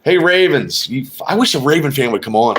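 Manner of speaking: normal talking voice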